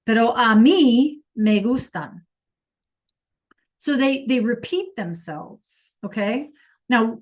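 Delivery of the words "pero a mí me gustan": a mí stressed, said louder; emphatic a mí. Retold or imitated. Kid contrasting himself with Billy, emphatic. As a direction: insist it's you who likes them. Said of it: "Not Billy".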